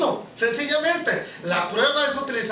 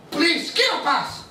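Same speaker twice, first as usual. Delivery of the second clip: mock desperate plea; 6 semitones higher, much slower than usual. Mock plea under trial.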